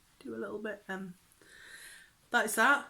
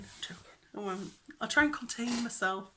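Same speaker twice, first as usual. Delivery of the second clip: usual speaking voice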